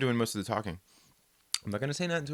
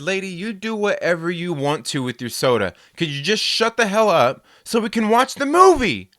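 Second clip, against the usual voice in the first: louder, higher retort; retold retort; 5 semitones higher, 6 dB louder than usual. Answering the soda threat, louder and exasperated. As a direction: snap back at the lady. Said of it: "I said".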